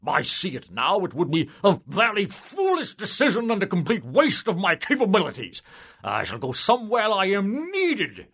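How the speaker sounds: high, loud self-important politician; pompous civil servant. A narrator's reading. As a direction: puff up and boast of your stellar record. Pompous soul refuses heaven.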